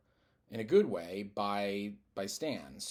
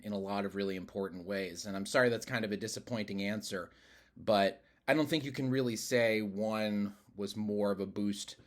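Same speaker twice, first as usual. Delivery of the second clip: ordinary talk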